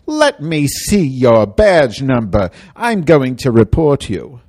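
slow, loud, furious demand; indignant. Retold or imitated. Rider scolding the bus driver, loud and slow. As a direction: bite off each word in outrage. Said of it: "I said".

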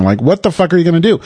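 normal talking voice

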